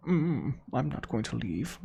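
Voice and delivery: slow, exaggerated Connecticut accent; put-on Connecticut accent. Resident declining to evacuate, in a slow mock Connecticut accent. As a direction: refuse slowly in a joke Connecticut voice. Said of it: "That's my Connecticut accent".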